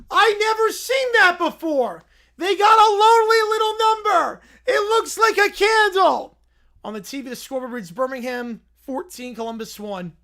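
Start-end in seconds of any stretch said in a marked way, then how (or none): 0.1-6.4 Little Brown Bear voice. Acted. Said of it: "He claps".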